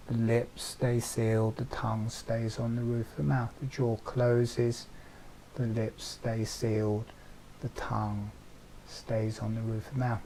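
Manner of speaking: calm instructor easing the jaw; guided relaxation. Acted. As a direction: talk them gently through the movement.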